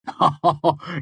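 sudden bright laugh, pitched high; laugh of understanding. Acted. Kvothe, suddenly understanding Chronicler's anger: bright high laugh. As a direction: burst into a laugh as it clicks. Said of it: "then laughed with sudden understanding".

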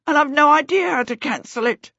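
high-pitched, frantic explaining; flustered, high-voiced confession; panicked babble. A narrator's reading. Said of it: "Peter babbled".

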